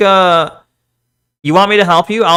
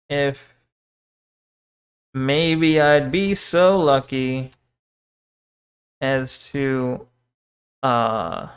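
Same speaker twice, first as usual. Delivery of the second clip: slow, halting drafting aloud, softer at pauses; hesitant composing; 3 semitones lower, 5 dB quieter, much slower than usual. Real, not acted. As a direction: feel for each phrase with long pauses. Still composing the message, hesitating.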